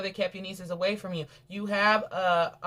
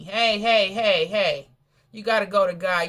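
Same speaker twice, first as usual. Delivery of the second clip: loud, firm hey hey to stop her; stern rebuke; 8 dB louder, slower than usual. Acted. Preacher loudly rebuking the greedy sibling, firm call.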